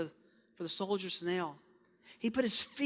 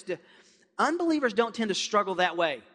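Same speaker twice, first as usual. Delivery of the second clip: ordinary delivery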